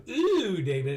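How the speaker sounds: high, stretched ew; mock disgusted whine. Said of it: "if you do".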